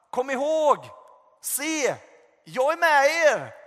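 loud, high call from above, slowing at the end; God calling out. Retold or imitated. God calling to the disciples from the sky, loud and high. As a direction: ring it out like a voice from heaven. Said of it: "höra Guds röst bara ropa".

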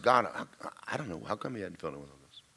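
very soft, low mutter to God; muttered aside. Retold or imitated. Preacher walks away and turns to God, muttered aside. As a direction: turn away and ask it under your breath.

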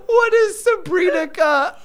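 high, crying with laughter; hysterical laugh. Real, not acted. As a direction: ask it while losing it. Laughing at own lyric.

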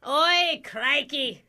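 broad, hammed-up Aussie; mock Australian owning up; fake Australian accent. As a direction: switch into a cartoon Australian accent.

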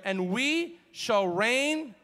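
slow, drawn-out proclamation; slow reading. Shown as a demonstration. Preacher has congregation say it together, slow emphatic reading. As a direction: stretch it out so everyone can join. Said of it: "read this slowly, say it together".

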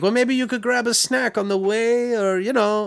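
high mock coaxing voice. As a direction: dangle a treat. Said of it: "he's like".

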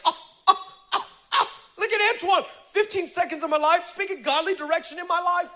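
very high, gushing squeal; mock thrilled. Preacher playing Darnisha, gushing over Antoine in a high squeal.

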